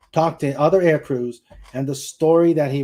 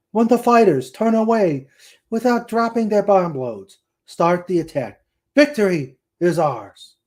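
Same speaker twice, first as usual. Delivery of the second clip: raised, higher commanding radio call; commander's order; 5 semitones higher, 5 dB louder than usual. A narrator's reading. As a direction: bark the attack order to the troops. Commander orders attack over communicator.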